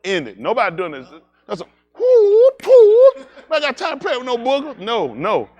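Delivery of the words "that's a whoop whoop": high, loud stretched whoop; hooting war cry. Acted.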